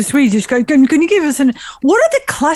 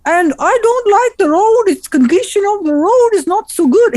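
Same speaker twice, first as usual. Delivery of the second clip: higher-pitched, lilting Norwegian English; put-on Norwegian accent, pitched up; 7 semitones higher than usual. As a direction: imitate a Norwegian neighbor speaking English. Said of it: "talk like this".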